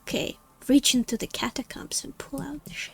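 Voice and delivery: unvoiced whisper. Real, not acted. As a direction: whisper it to yourself. Whispered while playing.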